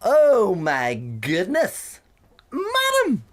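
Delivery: very high, drawn-out scandalised voice; mocking snooty customer. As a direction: gasp it out like a pearl-clutching lady. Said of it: "I'm like".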